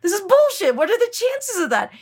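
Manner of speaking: squealing mock outrage; mock disbelief. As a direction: play the friends' shocked outcry. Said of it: "they're like".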